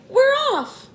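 very high-pitched cheer; excited cry. A narrator's reading. The others celebrating the peach moving, high excited cry. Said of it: "the others cried".

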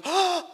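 sudden high mock-panicked gasp; acted alarmed gasp. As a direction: gasp as if disaster just struck. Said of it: "I look like".

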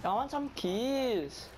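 very slow, high stretched whine; slow sulky whine. Real, not acted. Sulking for kills.

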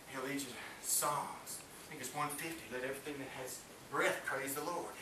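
ordinary talk

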